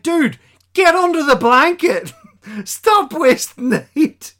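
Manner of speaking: high, loud mock scolding, slowing on the second line; hammed-up nag to get back under the blanket; exasperated crewmate.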